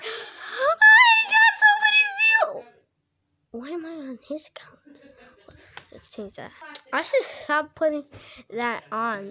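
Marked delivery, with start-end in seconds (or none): 0.0-2.6 high shrieking joy over views; shrieking jackpot winner. Acted. Shrieking over huge view count. 3.5-6.5 soft unvoiced sneaky whisper. Sneaky whisper on his account.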